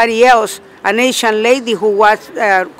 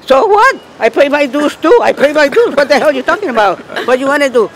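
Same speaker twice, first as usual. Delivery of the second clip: heated retort; 5 semitones higher than usual. Retold or imitated. Retold row with union woman.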